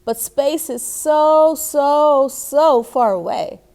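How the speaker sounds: put-on a child sighing at how far space is; little girl whining. May telling her dad space is so far, slow and high.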